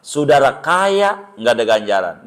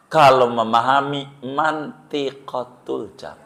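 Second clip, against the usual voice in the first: usual speaking voice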